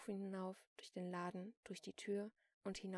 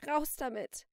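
loud, higher, furious order; forced demand; 6 semitones higher, 13 dB louder, slower than usual. A narrator's reading. Celine presses the injured shopkeeper, loud angry demand. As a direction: snap it out with force. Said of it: "presste Celine durch die Zähne".

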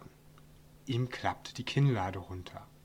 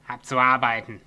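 curt, louder dismissal; gruff dwarf; 6 dB louder than usual. A narrator's reading. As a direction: bark it off, busy dwarf. Gruff dwarf brushes him off.

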